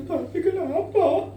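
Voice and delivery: put-on high, drawn-out tearful little-kid voice; imitated crying child. Child asking crying daddy what is wrong, imitated tearful whine. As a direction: whimper it like a small child. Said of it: "dia ikut nangis".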